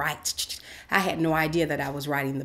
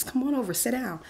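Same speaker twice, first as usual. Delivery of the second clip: beckoning; 8 semitones higher than usual. Retold or imitated. Voices mentor calling her over.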